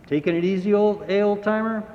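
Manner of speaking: high, mocking jibe; teasing firefighters. A narrator's reading. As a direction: rib the old man playfully. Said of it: "they laughed".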